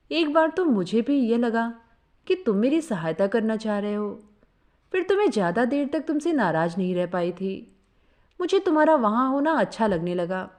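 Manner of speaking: ordinary delivery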